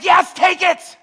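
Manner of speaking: high, loud mock yell; hammed-up exasperated holler; Peter exasperated. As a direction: shout it, fed up and impatient. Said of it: "And he's like".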